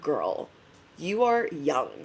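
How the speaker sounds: low, soft teasing; teasing friend. Retold or imitated. Speaker teasing her nineteen-year-old friend, low and soft. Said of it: "And I'm like".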